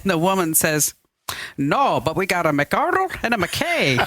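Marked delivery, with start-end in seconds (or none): 1.6-4.0 put-on Scottish accent. Scottish woman answering the stranded pilot, Scottish accent. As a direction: joke punchline in a Scottish accent. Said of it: "That's a good Scottish accent".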